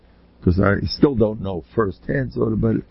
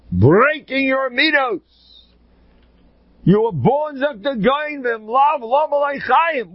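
high-pitched, insistent exhortation; impassioned preaching; 13 semitones higher than usual. Acted. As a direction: drive the message home at the top of your range. Preaches the life's purpose at high pitch.

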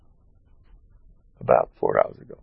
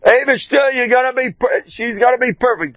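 fervent blessing; 13 semitones higher than usual. Retold or imitated. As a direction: call out the promise with full faith. Fervent blessing over the phone.

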